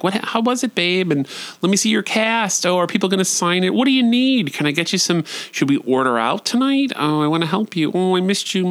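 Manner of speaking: high-pitched doting chatter; sweet, over-eager homecoming greeting; gushing affection. Retold or imitated. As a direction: fuss over your partner in a high voice. Acts out gushing homecoming to partner.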